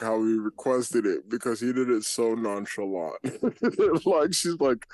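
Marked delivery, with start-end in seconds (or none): 3.2-4.0 high laugh; high real laugh; high burst of laughter. Real, not acted. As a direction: laugh out loud. Laughs at the story.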